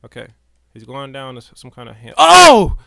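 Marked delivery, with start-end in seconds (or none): 2.2-2.8 sudden high loud yelp; a real jump-scare cry; startled yelp. Real, not acted. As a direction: jump out of your skin.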